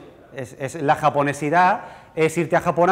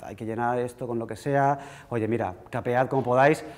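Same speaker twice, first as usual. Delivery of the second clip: dismissive; 3 semitones lower, 6 dB quieter than usual. Pretend. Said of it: "hubiera dicho".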